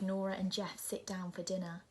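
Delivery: ordinary delivery, nothing marked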